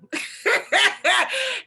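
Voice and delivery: a loud high burst of laughter. Real, not acted. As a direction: crack up at your own list. Laughs at own list.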